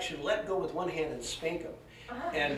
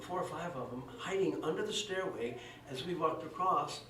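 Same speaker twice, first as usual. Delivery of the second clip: ordinary talk, nothing marked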